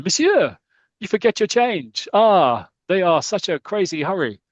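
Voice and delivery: high, loud mock French accent calling after them; a hammed-up French florist calling out. Paris florist calls after fleeing customers, mock French accent. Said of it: "the florist going".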